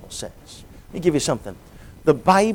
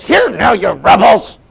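much higher, much louder angry boom; booming anger; 8 semitones higher, 12 dB louder, slower than usual. Shown as a demonstration. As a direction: thunder it at the crowd. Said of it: "his voice boomed with the emotion".

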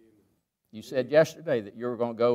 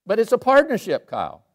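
higher and louder, pressing each phrase home; fervent preaching; 7 semitones higher, 5 dB louder than usual. Acted. Preacher urging Kyle going to Liberia, fervent preaching. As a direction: preach it, build the promise up.

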